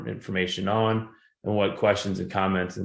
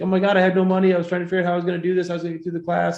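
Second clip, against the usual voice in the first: imitating a crying student; 10 semitones higher, 4 dB louder, much faster than usual. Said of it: "she starts crying".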